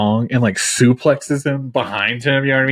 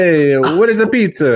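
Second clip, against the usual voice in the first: mock Italian; 4 semitones higher than usual. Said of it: "Italians are just like".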